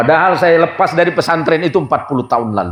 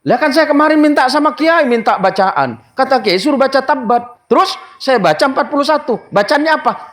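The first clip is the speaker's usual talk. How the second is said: higher, aggrieved villager complaining; indignant host; 9 semitones higher than usual. Retold or imitated. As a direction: protest like a cheated man. Host retells his mistake. (tuan rumah yang merasa tertipu, nada tinggi)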